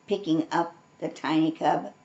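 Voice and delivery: ordinary delivery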